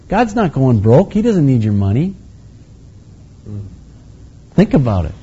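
ordinary talk

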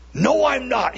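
high, heated protest; vehement denial. Acted. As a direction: deny it hotly, pitch leaping up. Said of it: "He cursed, he swore".

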